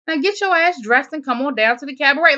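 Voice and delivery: high, loud mock telling-off; retold bossy scolding. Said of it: "they cuss each other out".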